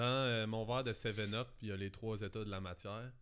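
ordinary talk

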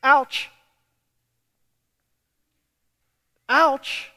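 high, loud pained ouch; mock ouch. Real, not acted. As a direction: wince out loud. Preacher reacts to own hard point.

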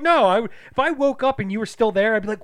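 high, loud mock scolding; indignant disbelief. Imagined waking to find partner still there, indignant scolding.

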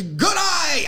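high, drawn-out Aussie greeting; mock Australian accent. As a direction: stretch out a hammed-up Australian g'day. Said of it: "if you say Australia".

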